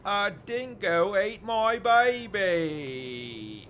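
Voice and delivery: slow, high, loud hammed-up Australian accent; over-the-top Aussie impression, slow and loud. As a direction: stretch it out in a big mock Australian accent. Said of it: "do your best Australian accent".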